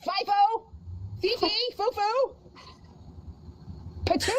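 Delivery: high mock dog calling. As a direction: call out pet names.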